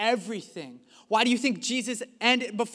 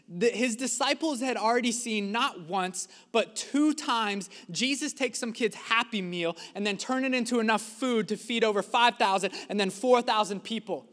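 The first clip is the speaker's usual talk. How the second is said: normal talking voice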